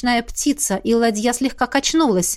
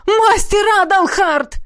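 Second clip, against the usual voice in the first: joyful greeting; 10 semitones higher, 5 dB louder, slower than usual. A narrator's reading. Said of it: "обрадовались Тиберлоны".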